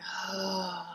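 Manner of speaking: long soft sigh through the mouth; deep sigh. Shown as a demonstration. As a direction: let out a pleasurable sigh. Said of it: "out through the mouth and a sigh".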